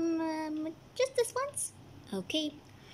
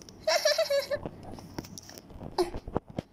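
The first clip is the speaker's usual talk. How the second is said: snooty laugh; 13 semitones higher than usual. Acted. Mocking laugh at princess.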